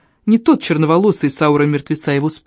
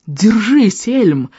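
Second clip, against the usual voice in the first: higher, urgent call to a friend; encouraging shout; 5 semitones higher, 3 dB louder, much slower than usual. Acted. Boy urging Elm to hold on, mild shout. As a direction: shout encouragement mid-fight.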